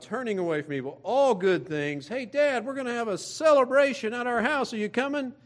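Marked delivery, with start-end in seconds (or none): 2.1-5.3 high-pitched, eager sons calling to Dad; eager invitation. Acted. Sons inviting their dad Job to a celebration, eager and high-pitched. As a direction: call out a cheery invitation, pitched well up.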